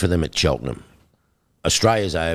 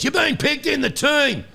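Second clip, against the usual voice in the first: high Australian team manager's voice, pitched well up; a much higher Australian official breaking the news. Retold or imitated. Team manager telling him on the phone, in a high Australian voice. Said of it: "this Australian voice goes".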